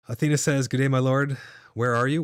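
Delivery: ordinary talk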